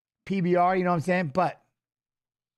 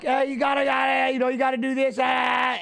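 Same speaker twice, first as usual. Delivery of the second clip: retold corner yell; 6 semitones higher than usual. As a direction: shout instructions at the fighter. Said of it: "you don't gotta yell".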